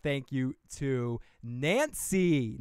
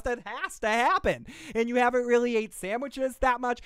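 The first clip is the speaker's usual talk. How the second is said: self-heckling voice; 7 semitones higher than usual. Pretend. Argues with himself in another voice.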